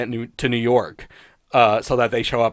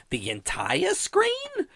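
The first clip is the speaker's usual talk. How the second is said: very high, slow, incredulous Lenny voice; Lenny's baffled echo; 6 dB quieter, much slower than usual. Retold or imitated. Reviewer imitating Lenny's one funny line, high and slow. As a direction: squeak the question up in disbelief. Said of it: "And then Lenny goes".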